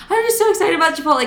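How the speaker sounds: high, fast mock-chirpy burst; fake excitement. Sister covering up for the nurse, mock fake excitement. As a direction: rush it out high with forced enthusiasm.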